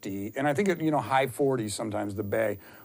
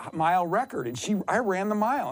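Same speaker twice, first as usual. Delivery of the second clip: ordinary talk, nothing marked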